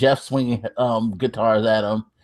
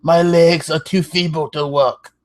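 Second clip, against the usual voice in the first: put-on horrible British accent; 5 semitones higher than usual. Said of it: "talking in, like, the horrible accent".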